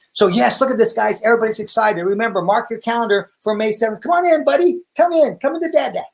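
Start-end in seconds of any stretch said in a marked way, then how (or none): 4.0-6.0 high, sing-song coaxing. Real, not acted. Dad coaxes his son to come say hi, high and sweet. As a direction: lure the little one in gently. Coaxing toddler onto camera.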